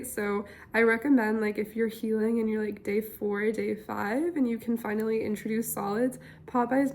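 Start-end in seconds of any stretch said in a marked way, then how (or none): none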